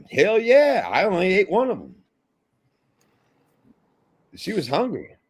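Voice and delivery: very high, whooping Southern boast; Southern guy. Retold or imitated. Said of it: "he was a Southern guy too".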